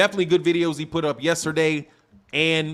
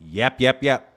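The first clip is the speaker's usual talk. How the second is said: mocking yap yap; 2 semitones lower, slower than usual. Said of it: "You doing all that yakking".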